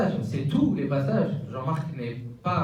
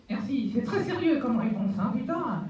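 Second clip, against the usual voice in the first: goofy muffled sock puppet; 11 semitones higher than usual. Acted. Puppet mocks the serious answer.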